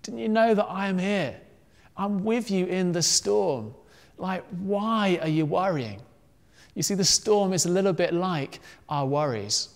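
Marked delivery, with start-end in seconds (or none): 0.0-6.0 a touch higher, easygoing reassurance; lightly voiced Jesus. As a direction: lightly act out a laid-back calming of friends. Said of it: "But Jesus was like".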